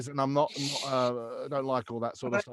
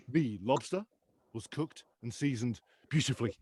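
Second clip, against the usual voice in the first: lower, softer grudging praise; Gordon Ramsay; 4 semitones lower, 6 dB quieter than usual. Acted. Chef handing praise to a cook, low and measured. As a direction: drop to a measured chef's verdict. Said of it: "even when he's handing out praise".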